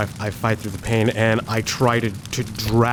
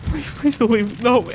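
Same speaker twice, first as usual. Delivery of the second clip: high, breaking please; begging him not to die; tearful pleading; 10 semitones higher than usual. Acted. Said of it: "He's like".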